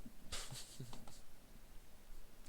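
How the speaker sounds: quick amused laugh; short laugh. Real, not acted. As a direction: laugh at your own scribble. Laughs at own brutal h.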